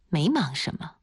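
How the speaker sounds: mildly offhand brush-off; perfunctory. A narrator's reading. Luo Jia answers rival's question, mildly perfunctory. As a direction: answer just to get it over with. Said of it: "他应付一样地说".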